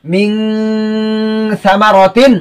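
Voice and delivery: মিন held as a long "ming" hum before সামারাতিন; hidden n, ghunnah. Shown as a demonstration. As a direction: recite মিন সামারাতিন slowly, humming the n. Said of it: "কি হবে?".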